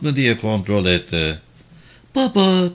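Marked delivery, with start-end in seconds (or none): 2.1-2.7 put-on high-pitched sleepy little child. A narrator's reading. Small child calls to Papa from the back seat, sleepy. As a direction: call out drowsily as a small child, higher. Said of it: "dedi Lumi uykulu bir sesle".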